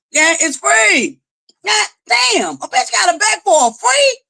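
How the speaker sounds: slow on it's free, then a high squeal; exasperated. Real, not acted. Streamer begs viewers for free likes, slow then high exasperated. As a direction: drag free out slowly, then go way up in disbelief.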